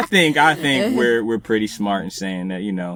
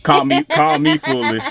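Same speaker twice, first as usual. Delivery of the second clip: playful; 6 semitones higher, 8 dB louder than usual. Real, not acted. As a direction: call me foolish, louder and higher, teasing. Playful self-mocking aside.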